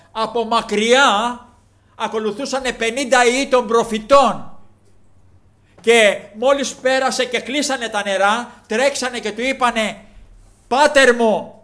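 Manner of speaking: loud, high urgent preaching; forceful preaching. Acted. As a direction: drive the story home at full volume. (δυνατό, επίμονο κήρυγμα)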